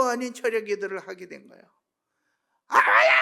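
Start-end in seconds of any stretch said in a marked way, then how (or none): 2.7-3.1 sharp loud cry to God; a loud anguished cry in prayer. Retold or imitated. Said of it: "막 부르짖으면서".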